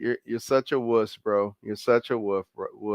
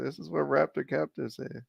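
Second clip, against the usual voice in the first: softer; 2 semitones lower, 7 dB quieter than usual. Shown as a demonstration.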